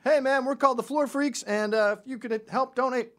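high, loud hype-man voice; high-pitched street dancer hustle; subway performer pitch. Acted. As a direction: call out to the train car like a busker.

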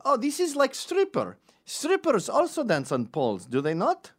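high, loud fake Polish accent; innocent-sounding Polish accent; dumb Polish guy. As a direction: play the clueless Polish tourist. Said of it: "pretending you're just a dumb Polish guy".

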